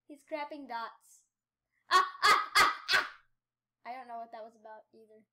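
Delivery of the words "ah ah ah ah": panicked yelps. Real, not acted. Panic in game.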